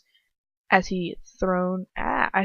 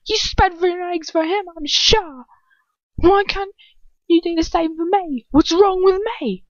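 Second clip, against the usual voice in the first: raging villain; 10 semitones higher, 5 dB louder than usual. Acted. Dolohov raging at the girl.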